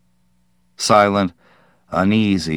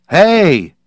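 much higher, loud hail; shout; 10 semitones higher, 6 dB louder than usual. Acted. Parkhurst hailing the kids across the field, loud shout. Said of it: "Parkhurst shouted".

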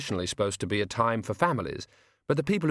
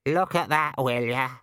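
lightly raised, cocky raven; chatty bird, a bit higher; 3 semitones higher than usual. Acted. The raven speaks.

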